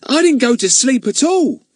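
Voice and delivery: put-on high, indignant boy's protest. Ern protests to Fatty, high and indignant. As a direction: bristle at the accusation. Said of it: "said Ern indignantly".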